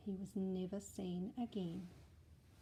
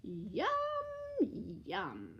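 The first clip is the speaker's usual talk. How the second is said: happy bear; 4 semitones higher, 4 dB louder than usual. Acted. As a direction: relish each yum. Bear relishes pie.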